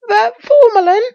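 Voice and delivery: very high, loud piping shout; piping bawl. A narrator's reading. Pycraft calling from inside the door, piping bawl. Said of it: "There came a piping bawl".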